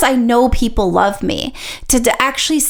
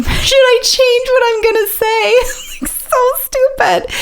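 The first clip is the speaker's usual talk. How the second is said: laughing at herself. Real, not acted. Laughing at her own overthinking.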